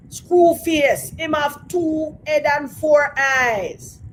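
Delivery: put-on slow, high Jamaican accent, loud at the start; menacing Jamaican movie villain. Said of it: "that horrible Jamaican accent".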